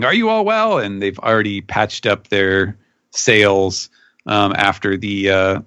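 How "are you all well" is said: mock shout. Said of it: "And he's shouting".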